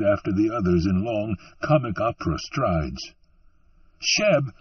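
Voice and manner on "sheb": high, restrained narrated scream; mild acted scream of a name; fearful, shrewish scream. A narrator's reading. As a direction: call after him in a high, shrewish woman's voice. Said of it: "the woman screamed after him".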